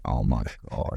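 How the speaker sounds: low, quiet groan of despair; mock despair. Cohost hearing the cold forecast, quiet despairing groan. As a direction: sink low into the words. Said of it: "I'm like".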